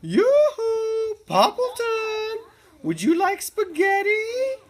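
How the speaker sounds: a bright high neighbourly call across the yard; calling out as Cherry Sue. A narrator's reading. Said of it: "she called out".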